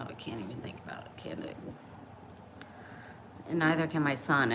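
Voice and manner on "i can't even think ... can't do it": disgusted mutter. Real, not acted.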